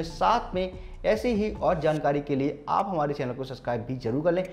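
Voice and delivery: ordinary talk, nothing marked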